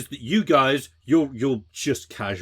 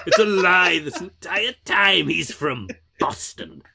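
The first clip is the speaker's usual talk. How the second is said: mock Boston accent; 6 semitones higher than usual.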